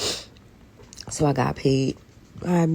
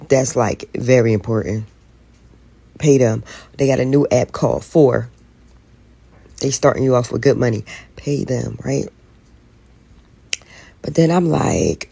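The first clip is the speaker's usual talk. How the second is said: normal talking voice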